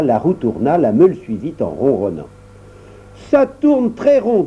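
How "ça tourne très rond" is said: much higher, slow and delighted; the dwarf admires the mill, much higher and slow. Acted. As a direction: savour the delight. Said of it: "dit le nain enchanté".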